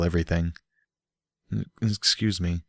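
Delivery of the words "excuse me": much softer guilty apology; sheepish soft apology. A narrator's reading. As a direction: mumble it ashamed. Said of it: "in a guilty voice".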